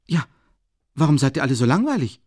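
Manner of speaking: high, loud, rapid outburst; sudden outburst. A narrator's reading. Sitting among silent guests, sudden impatient outburst. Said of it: "rief er plötzlich ganz unerwartet".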